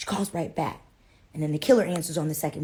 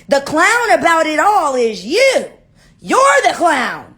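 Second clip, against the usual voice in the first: high, loud, drawn-out shouting; furious tirade; 7 semitones higher, 7 dB louder than usual. Acted. Susie blows up at Mary Ellen over the phone, furious shouting. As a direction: let rip, stretching the insults into a shout.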